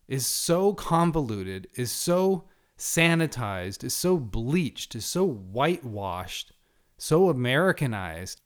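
each so leaned on, slowing down; slow emphatic list. Real, not acted. Preacher to listeners, slow emphatic complaint.

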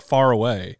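usual speaking voice

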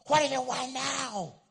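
loud, high, drawn-out yell; mock exasperated yell, stretched out; snapping parent. Preacher acts out the unloving way to communicate, exasperated yell. Harsh parent acted as bad example.

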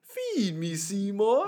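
high, loud plant monster growl; Audrey II voice. Retold or imitated. Quoting the movie to a friend, a loud monster plant voice. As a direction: belt it like the hungry plant. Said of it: "Little Shop of Horrors".

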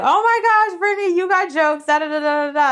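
high, loud mock hilarity, falling as it rattles on; imitated hysterical disbelief. Retelling a coworker laughing in her face, mock hysterical. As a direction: mimic someone finding it a huge joke. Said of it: "She hysterically laughed in my face".

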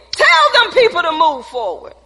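loud, high-pitched preaching; fiery preaching. Acted. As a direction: preach it with full fire. Preaching at full force.

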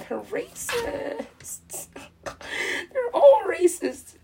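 high-pitched, drawn-out mock wail; stretched-out, squealing fake outrage; mock wailing accusation. Mock outraged accusation, laughing between.

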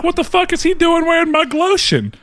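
imitated indignant outrage; high-pitched, amused mock indignation; mock pissed-off daughter. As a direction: play an offended daughter's protest. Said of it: "Like, she was pissed".

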